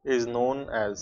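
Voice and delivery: slow, word by word, leaving pauses to write; slow dictation. Acted. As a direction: dictate it so the class can copy. Teacher dictating definition.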